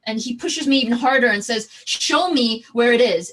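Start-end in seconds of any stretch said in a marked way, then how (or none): 1.8-3.3 loud demand. Retold or imitated. Intruder pushes and demands.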